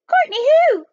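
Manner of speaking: very high, loud falsetto. A narrator's reading. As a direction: pipe up as a falsetto spy. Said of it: "and a falsetto voice".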